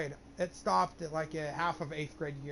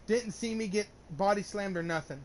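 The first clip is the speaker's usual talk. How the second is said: usual speaking voice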